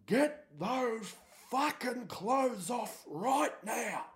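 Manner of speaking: high, slow furious yell; an enraged dad barking through the door; furious father. Retold or imitated. Father yelling inside house.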